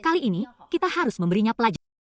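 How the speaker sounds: ordinary talk, nothing marked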